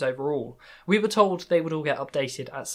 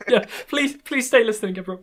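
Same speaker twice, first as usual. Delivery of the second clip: higher, laughing plea to stay; mock pleading; 6 semitones higher, 4 dB louder than usual. Real, not acted. Host to listeners after co-host's joke about them leaving, mock pleading. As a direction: beg playfully through a laugh. Jokingly begs listeners not to leave.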